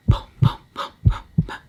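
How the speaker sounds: loud, percussive booms; voiceless, thudding sound effect; imitated footsteps. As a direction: punch out each boom like a heavy step.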